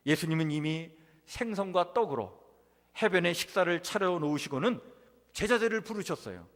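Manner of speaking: usual speaking voice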